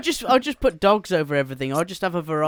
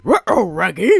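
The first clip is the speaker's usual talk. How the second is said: goofy cartoon dog voice, loud and swooping; mock alarm in a mangled dog-speak; Scooby-Doo; 5 dB louder than usual. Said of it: "I'll just put [name] as Scooby-Doo".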